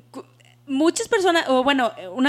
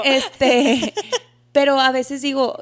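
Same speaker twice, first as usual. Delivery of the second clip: laughing out the words; 2 semitones higher, 5 dB louder, much slower than usual. Real, not acted. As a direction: giggle through it. Laughs while speaking.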